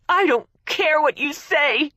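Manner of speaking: high, loud venomous retort. A narrator's reading. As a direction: spit the words at her. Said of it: "Gracie said venomously".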